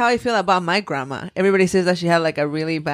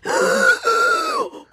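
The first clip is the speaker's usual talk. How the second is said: high sharp gasp; excited gasp. Real, not acted.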